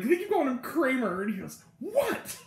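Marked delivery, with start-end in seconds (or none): 1.8-2.4 high, exasperated puppet squawk; annoyed Kermit. Retold or imitated. Puppeteer answering to the wrong name, high mock annoyance. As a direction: imitate a puppet at the end of its patience. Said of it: "getting more and more annoyed".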